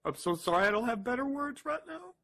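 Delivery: high, cracking voice fighting back tears; real grief breaking through the words. Real, not acted. Distraught father's farewell video.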